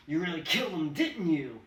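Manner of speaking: a mob of cops yelling in triumph; gloating cops. Acted. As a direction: shout it down at him, gloating. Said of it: "gloating, righteous exclamations".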